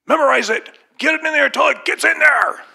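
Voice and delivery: hammed-up strict tutor pushing him to memorize; mimicking a drill teacher. Mock traditional teacher pushing memorization, high and loud. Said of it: "I know I'm mimicking someone that doesn't really exist".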